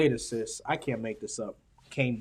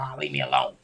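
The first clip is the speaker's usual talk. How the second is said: put-on low, gruff brush-off from an older man; a homeless old man waving off help, low and weary; grumpy older man; 8 semitones lower, 5 dB louder than usual. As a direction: shoo him away like a tired old man. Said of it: "He's like".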